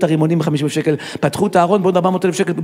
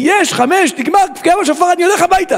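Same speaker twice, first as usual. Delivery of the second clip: high, loud cheer of release; jubilant relief; 14 semitones higher, 5 dB louder than usual. Acted. Worshipper thinks prayers are over and heads home, jubilant. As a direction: burst out like the final whistle blew.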